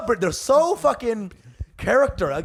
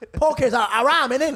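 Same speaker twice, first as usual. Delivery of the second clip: mock Birmingham accent; 6 semitones higher than usual. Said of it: "They want to say the H".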